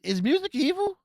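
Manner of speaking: high, loud baffled question; confused. Real, not acted. Asking viewers about the ending, high and baffled. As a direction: ask it like you can't believe it. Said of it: "I'm confused".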